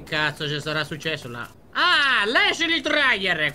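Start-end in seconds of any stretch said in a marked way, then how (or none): 1.7-3.5 high, drawn-out exclamation; excited exclamation. Real, not acted. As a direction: read the name out high and slow, stretching it. Calls out sign he spots.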